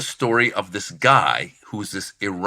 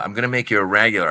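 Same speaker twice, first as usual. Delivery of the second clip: ordinary talk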